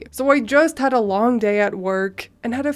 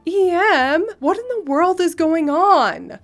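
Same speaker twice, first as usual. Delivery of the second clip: high, louder voice of disbelief; baffled friend; 6 semitones higher, 4 dB louder than usual. Acted. Friend demands an explanation from the mother, baffled. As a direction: pitch it up like a shocked friend.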